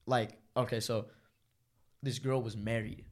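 usual speaking voice

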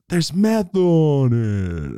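loud, stretched, sliding downward; slow-mo cry sinking in pitch; slow-motion. Acted. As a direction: drag it out like slowed-down film. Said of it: "I can hear it in slow-mo".

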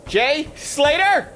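high, urgent calling; calling out anxiously. Acted. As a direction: call out, scared. Calling out for missing friends.

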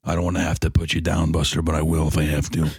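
a touch of sinister Arthur; a hint of low menace. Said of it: "And he's like".

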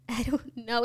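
a baffled whisper. Real, not acted. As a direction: whisper it as if stumped. Puzzled about scalp pain.

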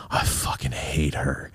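slow, low whisper; retold hatred, kept under the breath; hushed loathing. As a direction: imitate a slow, whispered confession of hate. Husband's confession voiced, hushed.

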